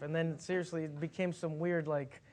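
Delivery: ordinary talk, nothing marked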